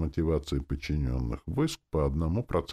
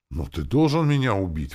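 raised, insistent protest; exclaiming; 4 semitones higher, 6 dB louder than usual. A narrator's reading. Captain insists the narrator must kill him, exclaiming. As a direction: exclaim it, a little louder. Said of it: "воскликнул капитан".

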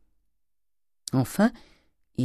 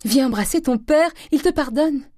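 high, loud, elated call; joyful cry; 12 semitones higher, 5 dB louder than usual. A narrator's reading. Mother summoning her daughter to be forgiven, joyful cry. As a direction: call out the good news, overjoyed. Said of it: "cria la mère en rougissant de joie".

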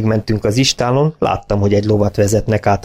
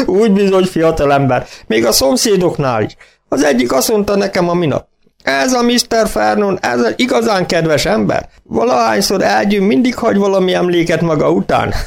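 much higher, gleeful farmer voice; gloating farmer; 11 semitones higher than usual. A narrator's reading. Farmer telling the young vet the neighbour's jibe, high and gleeful. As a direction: savour the neighbour's joke. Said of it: "úgy hahotázott".

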